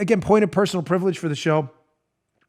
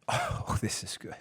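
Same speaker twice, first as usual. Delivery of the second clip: whisper; 11 semitones lower, 18 dB quieter than usual. Real, not acted. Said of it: "whispered aside".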